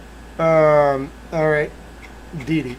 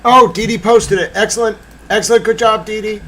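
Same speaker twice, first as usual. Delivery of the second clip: high, loud, delighted outburst; excited viewer; 7 semitones higher, 7 dB louder than usual. Retold or imitated. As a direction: cheer it out like a thrilled fan. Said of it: "if you're wondering".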